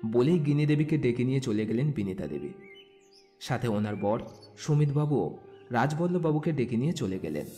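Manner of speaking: ordinary delivery, nothing marked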